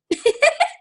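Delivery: loud high burst of laughter. Real, not acted. Laughing at teammate Katie.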